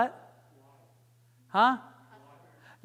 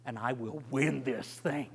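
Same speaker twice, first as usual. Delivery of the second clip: arrogant; 7 semitones lower, 7 dB quieter than usual. Shown as a demonstration. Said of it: "Maybe not so arrogant".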